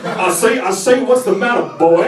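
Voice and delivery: blustery Southern accent like the cartoon rooster; Foghorn Leghorn accent. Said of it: "with that accent".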